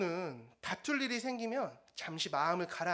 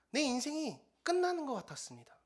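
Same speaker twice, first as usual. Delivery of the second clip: ordinary delivery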